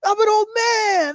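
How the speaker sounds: high, slow, put-on wail; imitated protest, stretched and high; mock exasperated character. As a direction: drag it out in a hammed-up high voice. Mocking the other side of quarrel.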